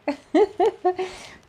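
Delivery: high, loud laugh; amused laugh. Real, not acted. Psychic laughs about taking a group, high laugh. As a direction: laugh at her own joke.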